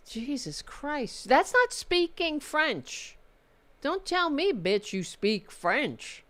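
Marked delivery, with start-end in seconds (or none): none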